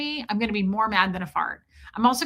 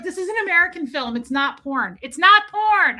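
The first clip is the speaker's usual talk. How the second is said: higher, rising into a shout; panicked insisting; 7 semitones higher, 5 dB louder than usual. Retold or imitated. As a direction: insist frantically, louder each time. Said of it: "I was like".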